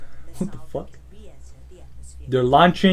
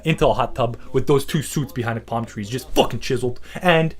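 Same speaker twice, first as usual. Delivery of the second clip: normal talking voice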